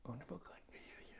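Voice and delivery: high breathy whisper, imitating; whispered imitation. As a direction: whisper it back, mimicking a mumble. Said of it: "He, he eats his words".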